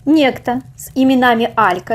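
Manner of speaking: usual speaking voice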